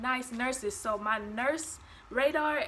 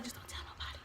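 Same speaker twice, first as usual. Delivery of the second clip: whispered secret; 14 dB quieter, much faster than usual. Retold or imitated. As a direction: breathe it like a secret. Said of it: "she was like".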